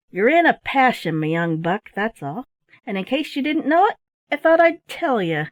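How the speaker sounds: put-on a sailor gravely teasing the boy; gruff sailor. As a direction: sea dog giving blunt advice. Sailor's voice in story.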